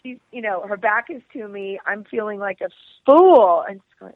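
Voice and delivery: ordinary talk, nothing marked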